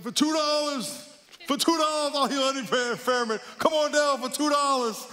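high-pitched revival-tent pitchman; hammed-up healer calling the crowd, very high; faith-healer preacher impression. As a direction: holler it out like a huckster healer. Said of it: "playing a, uh, faith healer, preacher".

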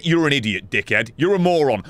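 ordinary talk

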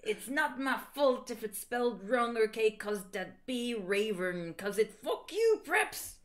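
high-pitched bratty author's note; petulant author. Acted. Reads author's note in character.

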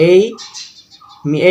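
ordinary talk, nothing marked

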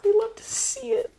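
high squeal of delight. Real, not acted. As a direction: squeal it like a fan seeing her favourite book. Gushing over the book cover.

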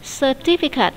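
"Certificate" stressed on "ti"; stress on second syllable. Shown as a demonstration. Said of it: "nhấn âm hai".

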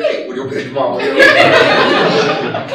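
mock scolding dissolving into laughter; laughing tease. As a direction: tease them while cracking up. Mocking teasing colleague.

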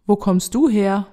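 usual speaking voice

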